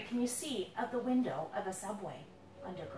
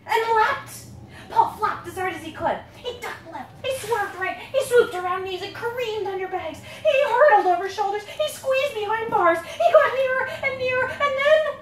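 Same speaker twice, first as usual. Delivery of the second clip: higher and louder to a shouted freedom; building excitement; 6 semitones higher, 11 dB louder than usual. A narrator's reading. Parent reading the escape to the kids, building to a joyful shout. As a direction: race toward the door, then cry out.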